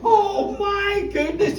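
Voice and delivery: put-on boy's breathless joy at the top of the hill; awestruck excitement. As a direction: gasp at the view like a thrilled kid. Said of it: "he was so excited".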